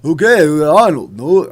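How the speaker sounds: loud, slurred tough-guy impression; Sylvester Stallone. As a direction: protest in a bad action-star voice. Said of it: "I say it's bad".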